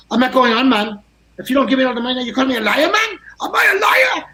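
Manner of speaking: Jack ranting. Retold or imitated. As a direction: build to an outraged yell. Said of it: "he's like".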